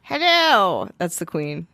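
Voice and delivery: mock queen voice. Mock posh queen greeting.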